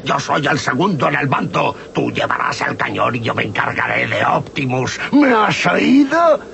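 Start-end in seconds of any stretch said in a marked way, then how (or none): 5.1-6.4 angry. Acted. Villain demands, angry.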